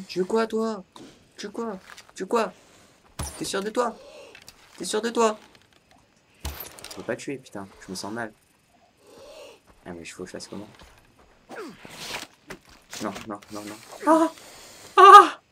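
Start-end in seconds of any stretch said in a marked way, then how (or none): 0.1-5.4 high-pitched taunting questions; mock challenge. Taunting the snake in game. 15.0-15.4 high, loud yelp of fright; startled scream. Real, not acted. Startled in game.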